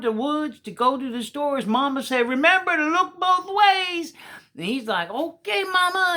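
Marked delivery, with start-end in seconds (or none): 2.3-4.1 high, loud reminder; calling after. Acted. Mama calls after her running son, high and loud. 5.1-6.1 put-on higher, eager boy's reply. Little Walker answers his mama, eager and high.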